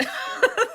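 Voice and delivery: a quick, breathless burst of laughter; startled high laugh. Real, not acted. Crafter laughing after a scrap hits her face, startled laugh. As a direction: laugh it off, caught by surprise.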